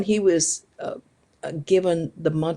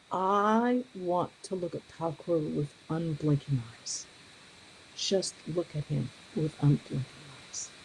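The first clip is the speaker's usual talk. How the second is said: reverent; 6 dB quieter than usual. Retold or imitated. Swami describing his wish at death, soft and reverent. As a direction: say it with quiet longing. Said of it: "And he said".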